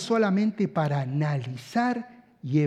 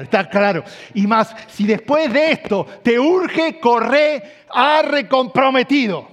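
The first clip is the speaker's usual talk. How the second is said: much higher and louder, slow hammering delivery; full-force preaching; 8 semitones higher, 11 dB louder than usual. Acted. Pastor urging husbands to build love, preaching at full force. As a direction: preach it loud, driving every phrase home.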